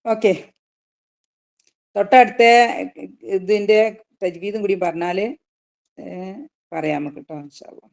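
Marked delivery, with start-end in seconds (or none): none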